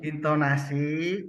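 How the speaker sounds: slow, drawn out, rising at the end; leading a drill. Shown as a demonstration. Trainer asks participants to follow along, leading a drill. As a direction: stretch it out for the group to echo. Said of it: "ikuti saya".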